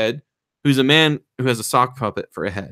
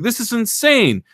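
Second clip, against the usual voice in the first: mock incredulous guys; 11 semitones higher, 3 dB louder than usual. The guys voiced in disbelief.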